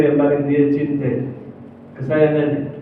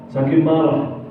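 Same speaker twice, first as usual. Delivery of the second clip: usual speaking voice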